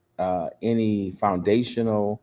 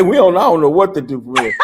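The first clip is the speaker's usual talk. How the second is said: laughing; 8 semitones higher, 9 dB louder than usual. Real, not acted. Laughing quote.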